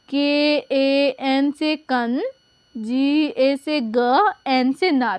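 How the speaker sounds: slow letter by letter; patient teacher spelling. Shown as a demonstration. Teacher slowly spells a name for pupils. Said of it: "तो देखिए".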